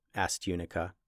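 ordinary delivery

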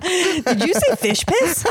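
high, slow question through laughter; cracking up. Real, not acted. Co-host catching the fish joke, helpless laughter. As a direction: squeeze the question out while laughing.

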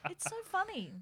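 mocking cousin.

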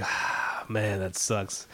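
slow, breath-out sigh into deflated words; mock dejection with a sighed start; retold disappointment. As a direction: imitate a let-down buddy, dragging it out. Acts Jimmy's deflated reaction to loss.

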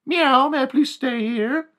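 high, slow, sweet little-cat voice; polite cat meow. Acted. Cat asking owner for a home, high and sweet. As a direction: beg politely like a tiny kitten.

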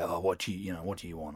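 a hint of a put-out Martin; lightly voiced grumpy Martin. Imagined Martin answering awkwardly.

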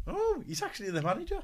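high-pitched retold surprise; astonished thought, replayed for listeners; surprised realisation. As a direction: act out the penny dropping, voice jumping up. Retold thought on discovering manager.

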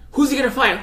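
imitating an eager announcer, building up; hyped announcer question. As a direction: ask it like a commentator dying to know. Mimicking hyped fight announcers.

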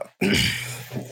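sharp throat clear. Real, not acted. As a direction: clear the throat to get the frog out. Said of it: "had something in my throat there".

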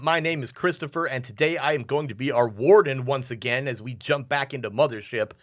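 bright, high announcer welcome; show host intro. Acted. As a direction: open the show like a radio host.

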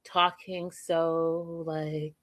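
ordinary delivery

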